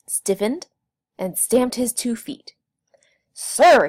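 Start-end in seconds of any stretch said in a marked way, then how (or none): 3.3-3.9 a sharp, much louder cry of the word. A narrator's reading. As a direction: bark it out to stop the logger. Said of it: "he said loudly".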